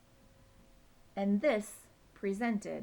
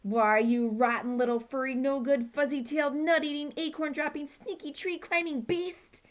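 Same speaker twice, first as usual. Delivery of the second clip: loud, building higher angry rant; yelling; 6 semitones higher, 7 dB louder than usual. Acted. Poo Poo yelling at the acorn-dropping squirrel. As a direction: hurl each insult up the tree. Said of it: "he yelled".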